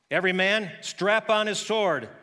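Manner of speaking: loud, slow commander's order; battle order. Acted. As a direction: call the troops to arms. David ordering his men to battle.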